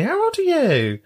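high-pitched quoted question; imitated queen. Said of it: "this queen said to me".

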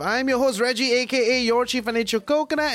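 upbeat show opening; bright host welcome over music; podcast host intro. Acted.

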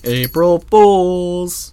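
drawn-out shout. Pretend. Mimicking the catchphrase.

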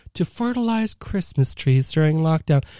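ordinary talk, nothing marked

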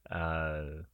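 normal talking voice

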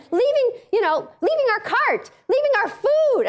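high-pitched mock child complaint; put-on high kid voice; little kid. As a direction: wail it as an outraged small child, in play. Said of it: "your little kid is going".

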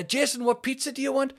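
high-pitched Mancunian call; Mancunian accent. Retold or imitated. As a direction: imitate a Manchester aunt calling out, high. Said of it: "which in a Mancunian accent".

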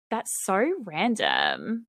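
slow, drawn-out catchphrase impression; Summer Heights High. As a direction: stretch it out like a quoted TV character. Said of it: "one line from Summer Heights High".

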